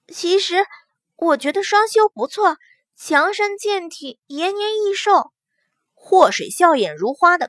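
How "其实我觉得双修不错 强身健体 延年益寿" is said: put-on playful young woman. Young woman character teasing.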